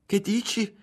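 high-pitched startled question. A narrator's reading. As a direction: jolt out of your thoughts. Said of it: "sussultò Ario".

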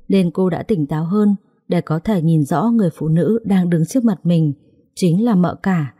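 ordinary delivery

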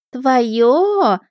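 put-on high, stretched, sceptical girl; doubtful drawl. A narrator's reading. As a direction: draw the word out in loud disbelief. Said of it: "с сомнением протянула русалка". (с сомнением, протяжно)

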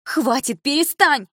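high, rising desperate shout; mother shouting. A narrator's reading. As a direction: beg them to stop, yelling. Mother stops the quarrel. (мать кричит, прекращая ссору)

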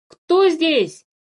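high-pitched loud call. A narrator's reading. Philipp calls out to whoever is nearby, calling out. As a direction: call out into the woods. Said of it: "громко позвал Филипп".

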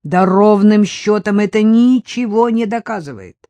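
the husband flares up and cuts her off; boiling over. A narrator's reading. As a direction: snap back, boiling with anger. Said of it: "вскипел муж".

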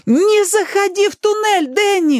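very high, stretched-out shout; desperate wail. A narrator's reading. Ali warning Danny off the tunnel, wailing. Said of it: "вопила Али".

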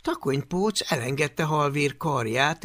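ordinary delivery

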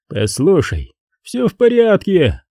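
plain reading voice

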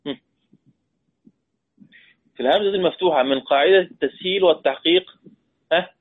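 ordinary talk, nothing marked

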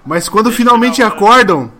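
projected, emphatic storytelling; game master narration. A narrator's reading. Game master resuming the ending after interruption, projected dramatic narration. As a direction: carry the ending out firmly over the room.

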